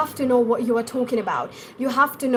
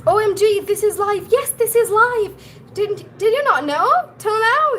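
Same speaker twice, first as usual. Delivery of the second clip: high-pitched, louder OMG reaction; playfully incredulous, pitched right up; mock astonishment; 9 semitones higher, 4 dB louder than usual. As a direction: exclaim in exaggerated disbelief at the viewer. Reacting to viewer surprised it's live.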